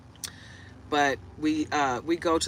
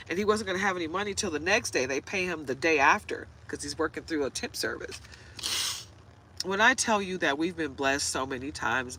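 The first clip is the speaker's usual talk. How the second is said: ordinary talk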